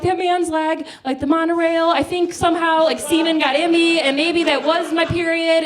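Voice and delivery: high, loud rushed panic; a scared kid explaining to mom, high and loud; frantic explanation. Retold or imitated. Frantic child explaining monorail theory.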